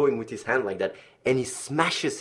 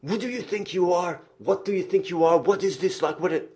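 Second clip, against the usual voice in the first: angry boss; 8 semitones higher than usual. Retold or imitated. Boss confronting him angrily.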